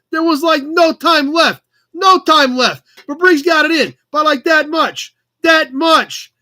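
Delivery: put-on high, loud New York accent; excited auctioneer hamming up a New York accent; terrible New York accent. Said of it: "talk like that anymore".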